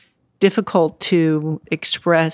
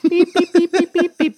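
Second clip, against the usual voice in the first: high mock censor beeps; bleeping words out; 13 semitones higher than usual. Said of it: "those words out".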